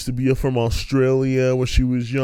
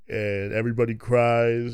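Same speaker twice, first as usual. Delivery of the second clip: usual speaking voice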